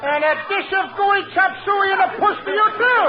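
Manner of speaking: high-pitched mimicking comeback; mocking retort. Comic returning the lady's farewell, mocking high-pitched retort. Throws her mock Chinese farewell back.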